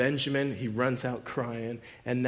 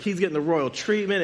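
normal talking voice

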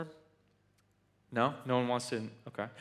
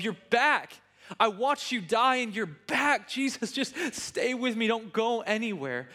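desperate joy; 6 semitones higher than usual. Retold or imitated. Mary clinging to risen Jesus in the garden, desperate and overjoyed. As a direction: beg him to stay, overwhelmed. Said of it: "just desperately in love".

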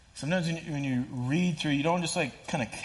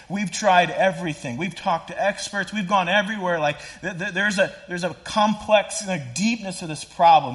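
exasperated; 6 semitones higher, 7 dB louder than usual. Real, not acted. Exasperated about unsolvable problems.

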